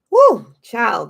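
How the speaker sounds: excited whoop. Real, not acted. Excited exclamation.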